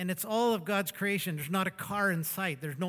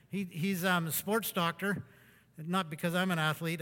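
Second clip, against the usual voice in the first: normal talking voice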